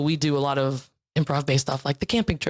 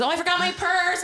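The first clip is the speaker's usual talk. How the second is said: mock dismay; 12 semitones higher than usual. Acting forgetful bar-goer.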